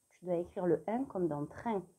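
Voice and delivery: usual speaking voice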